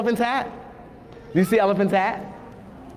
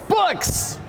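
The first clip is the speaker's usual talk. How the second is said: excited reveal; 13 semitones higher than usual. Real, not acted. Reveal to kids.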